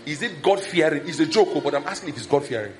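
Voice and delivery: usual speaking voice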